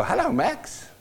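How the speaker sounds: high squawky parrot greeting. Retold or imitated. Preacher imitating the parrot greeting visitors at the vet, squawky. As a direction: sound like the bird mimicking.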